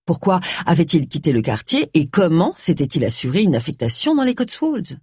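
ordinary delivery, nothing marked

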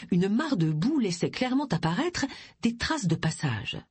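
ordinary delivery, nothing marked

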